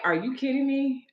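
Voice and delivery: high, shocked "are you kidding me"; high incredulous retort; disbelief. Retold or imitated. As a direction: throw it out in disbelief. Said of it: "I was like".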